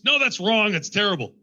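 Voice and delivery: mock scolding director; dismissive director. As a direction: shoot the take down like a harsh director. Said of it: "there are directors that still do this".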